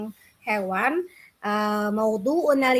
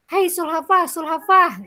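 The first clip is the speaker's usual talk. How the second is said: louder and higher calling out; 5 semitones higher, 5 dB louder than usual. Acted. Bird calls the turtle in a loud, high voice. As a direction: hail the turtle from a distance. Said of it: "dengan suara yang kencang".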